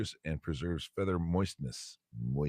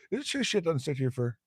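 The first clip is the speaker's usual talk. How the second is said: higher put-on bear asking the rabbit; 9 semitones higher, faster than usual. Bear in the joke quizzing the rabbit, higher put-on voice. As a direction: play the curious bear, pitched up.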